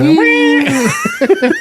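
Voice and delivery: squealing high laugh. Real, not acted. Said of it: "He's like".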